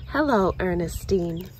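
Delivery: ordinary delivery, nothing marked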